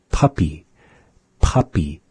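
"puppy" with a heavy puff of breath on the first P; exaggerated P. Shown as a demonstration. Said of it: "really exaggerate those".

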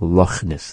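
"Loch" ending in a throaty rasp; Scottish raspy CH. Shown as a demonstration. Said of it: "when they say that".

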